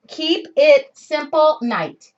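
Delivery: "Keep It Simple night" slowly, each word set apart; slow emphasis. Real, not acted. Repeats the name for emphasis.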